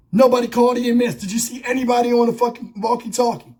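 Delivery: ordinary delivery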